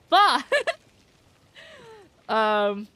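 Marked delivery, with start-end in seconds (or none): 0.1-0.4 sheep bleat. Pretend. Sheep bleat after sheep joke.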